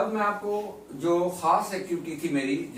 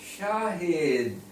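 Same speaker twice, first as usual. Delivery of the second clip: drawn-out name; 2 semitones higher, much slower than usual. Shown as a demonstration. Teacher sounds out the finished name.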